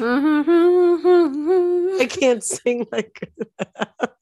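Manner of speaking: high, slow words through laughter; laughing. Real, not acted. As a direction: laugh while protesting. Laughing after raspy voice joke.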